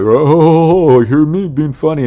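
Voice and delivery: high, loud mock show-off voice; hammed-up, high-pitched "look at me" mockery; mocking attention seekers. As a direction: imitate a guy desperate to be found funny. Mocks guys desperate to seem funny.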